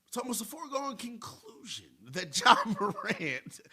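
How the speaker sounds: hushed, high-pitched mock impersonation, half laughing; Brian Windhorst. Radio host doing his best reporter impersonation, high, soft and giggly. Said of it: "do my best Brian Windhorst impersonation".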